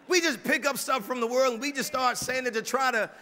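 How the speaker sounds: usual speaking voice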